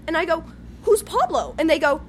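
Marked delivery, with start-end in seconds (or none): 0.8-1.5 higher-pitched acted puzzlement; puzzled mock question. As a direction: ask as if baffled by the name.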